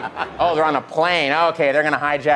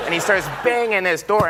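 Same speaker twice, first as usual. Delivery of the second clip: usual speaking voice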